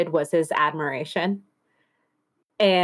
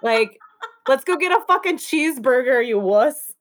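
higher, incredulous scoff at a crier; mocking scorn; 8 semitones higher than usual. Mocking a lover who cries after sex, scornful. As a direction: scoff at him, half laughing. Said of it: "I'd be like".

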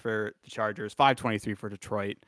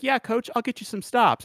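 much higher put-on player voice; lightly hammed-up volunteering, pitched way up; mock eager player; 12 semitones higher than usual. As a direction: pipe up high to the coach. Voicing player chiming up to coach.

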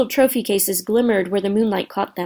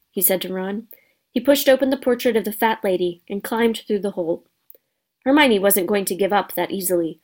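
ordinary delivery

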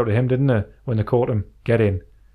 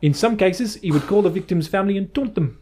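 higher-pitched attempt at a South African accent; 3 semitones higher than usual. Reading a killer's card to a co-host in South African accent. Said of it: "Should I read this out in a South African accent".

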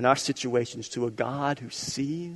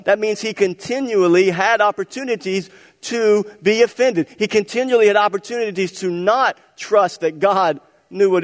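loud, high preaching build; full-force preaching; 6 semitones higher, 8 dB louder than usual. Acted. Pastor urging the church to hear him, loud preaching. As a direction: raise it up to a pulpit climax.

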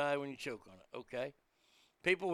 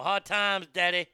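a light, louder take on a wrestler's drawling promo; Dusty Rhodes promo; 8 dB louder than usual. Retold or imitated. Man recites a wrestling promo every day, loud and hammy. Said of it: "recites the Dusty Rhodes Hard Times promo".

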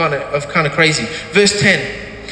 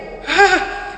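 short high laugh; delighted laugh; 10 semitones higher than usual. Real, not acted. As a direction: break into a laugh. Laughs after the quote.

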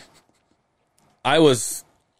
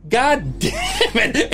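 laughing groan; 13 semitones higher, much slower than usual. Retold or imitated. Losing the blanket.

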